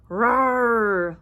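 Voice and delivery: loud, drawn-out roar; full-voiced growling roar; lion roar. A narrator's reading. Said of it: "roared the lion".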